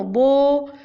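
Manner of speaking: बो said alone, loud, lips rounded; isolated syllable. Shown as a demonstration. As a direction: give बो on its own, round ओ. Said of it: "ध्यान से सुनो".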